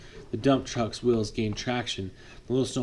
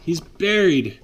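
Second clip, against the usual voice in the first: loud higher alarmed yell; 6 semitones higher, 7 dB louder, slower than usual. Acted. Foreman Will yelling that the truck is buried. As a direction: shout the alarm. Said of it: "Will yelled".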